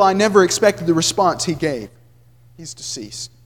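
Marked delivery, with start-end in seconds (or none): 2.6-3.3 low, soft, voice breaking; voice cracking. A narrator's reading. Brother breaking the news, voice cracking. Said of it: "His voice cracked".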